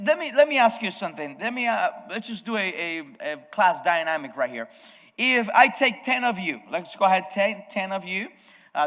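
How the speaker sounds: ordinary talk, nothing marked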